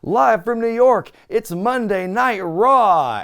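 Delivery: loud, high, drawn-out show intro; big TV cold-open shout, stretching the last words; show-opening announcement. Retold or imitated. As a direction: imitate a group hollering the show's opening. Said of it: "everyone on camera just looks in and goes".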